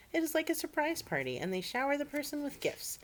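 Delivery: cheery explanation. Acted. Mister Rogers explains.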